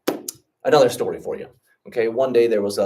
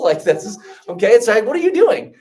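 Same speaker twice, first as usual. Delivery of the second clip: exasperated disbelief; 10 semitones higher, 8 dB louder than usual. Retold or imitated. Frustrated student retold.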